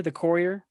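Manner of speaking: "Courier" with an "or" first vowel, questioning rise; trying a pronunciation. Shown as a demonstration. Host testing how to pronounce the film title, "Courier" as KOR-ee-er. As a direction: try "Courier" as KOR-ee-er, unsure. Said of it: "is that how you pronounce it?".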